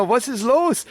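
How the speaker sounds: confused German. Retold or imitated. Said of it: "And I'm going".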